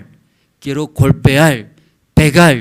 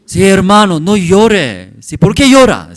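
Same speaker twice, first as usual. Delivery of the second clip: loud and higher, imitating a stern pastor; loud scolding; 6 semitones higher, 8 dB louder than usual. Pastor at a wake rebuking the weeping family, loud scolding. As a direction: call it out across the room like a rebuke. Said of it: "sí, fuerte hablo".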